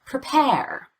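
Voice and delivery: "Prepare" with quick weak "Pre", long falling "pare"; stress on second syllable. Shown as a demonstration. Teacher models prepare for learners, stress on "pare" of "Prepare". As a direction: rush "Pre", put all the pitch change on "pare" in "Prepare". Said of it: "gets all the stress".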